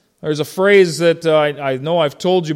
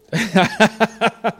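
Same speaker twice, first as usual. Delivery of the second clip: laugh; 4 semitones higher than usual. Real, not acted. Laughing at own joke about dad.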